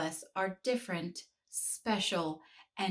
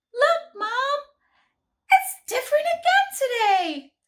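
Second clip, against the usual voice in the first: put-on excited little girl; 9 dB louder than usual. A narrator's reading. Jenny excited to her mom.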